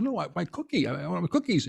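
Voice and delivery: hammed-up eager plea; mock pleading. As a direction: whine it like a kid wanting cookies. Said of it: "I said".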